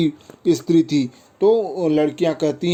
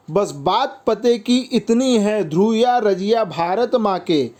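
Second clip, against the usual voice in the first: higher-pitched, measured verse; poem recitation; 5 semitones higher than usual. Acted. Boys and girls agreeing in the verse, poem recitation. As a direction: recite the line with lifted pitch.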